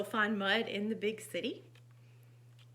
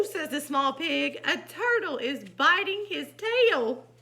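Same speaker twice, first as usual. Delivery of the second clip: animated, loud exclamations between narration; lively storytime voice; story narration; 9 dB louder than usual. A narrator's reading. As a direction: read to children with dramatic emphasis. Reads picture book aloud.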